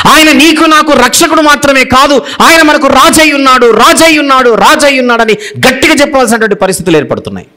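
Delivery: preacher declaring Christ is king; forceful preaching. Acted. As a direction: proclaim him king to the congregation.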